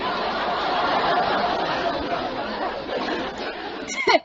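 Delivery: breathy giggle. Acted. Son laughs at joke.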